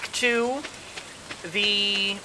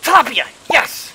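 excited; 5 semitones higher, 5 dB louder than usual. Real, not acted. Catches the fish she wanted.

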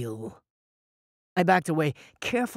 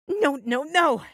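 panicked no; 10 semitones higher, 3 dB louder, slower than usual. Acted. Panicked stumbling.

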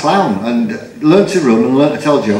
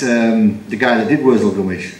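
usual speaking voice

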